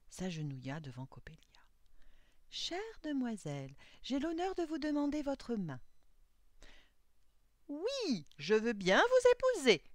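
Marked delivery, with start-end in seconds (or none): 2.5-5.8 high, earnest formal proposal; ardent suitor. A narrator's reading. Young man kneels before the doll, high ardent proposal. 7.7-9.8 high, loud, exaggerated yes; gleeful cry. A narrator's reading. Disguised girl accepts the fooled suitor, high gleeful cry. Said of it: "s'écria Svanhilda en se retenant de rire".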